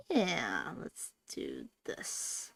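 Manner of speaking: soft whisper to herself. Real, not acted. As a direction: whisper it conspiratorially. Quiet move during play.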